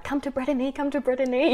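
high-pitched, imitating insistent fans; eager urging. Voicing eager YouTube commenters.

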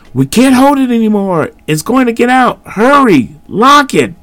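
high straining cries; panicking as the door gives; panicked shout. A narrator's reading. Boys losing the door.

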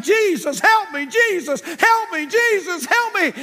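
high, loud desperate cries; hollering for help. Acted. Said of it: "screaming and hollering".

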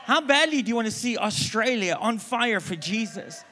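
loud, rising altar-call challenge; preaching at full force. Acted. Preacher challenging the church, preaching at full force. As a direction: push it out hard, stressing badly.

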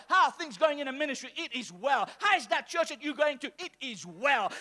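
ordinary talk

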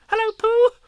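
very high, loud squeak; squeaky Roo. Acted. Roo squeaking a greeting to Pooh at the party, very high and loud. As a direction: squeak it like an excited baby kangaroo. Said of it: "he squeaked".

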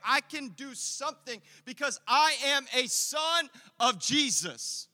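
loud, high, ringing preacher's call; rally the congregation with every line; preaching at full force. Acted. Pastor rallying his church to serve, preaching at full force.

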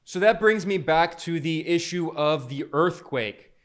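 ordinary talk

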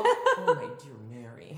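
character impression. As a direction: leap up on the first word, then sink through a laugh. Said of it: "The only impressions I can do".

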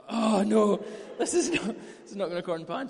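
high, loud mock alarm; mock panic. [name] panicking as the plan falls apart, loud mock panic. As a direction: panic it out comically.

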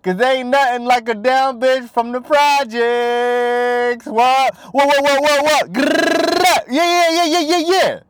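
high, slow, drawn-out rap quote with hyped ad-libs; squeaky hype-man ad-libs climbing to a growl. Retold or imitated. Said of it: "Young Ma told you".

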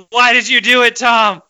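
high, loud mock-anguished cry at Tom; exasperated plea. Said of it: "I was like".

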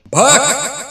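sharp high loud scoff; dismissive exclamation. Real, not acted. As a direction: scoff it off loudly. (ज़ोरदार झिड़की)

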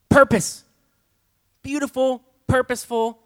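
bursting out with wonder, high and loud; loud exclamation. Real, not acted. Preacher marvelling aloud at the congregation. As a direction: proclaim each word like a revelation.

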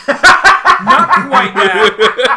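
laughing. Real, not acted. Laughing at the Val Kilmer quip.

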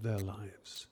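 whispered, hushed and intimate; soft whispered close. Real, not acted. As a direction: breathe it out as a secret. Sermon climax, hushed ending.

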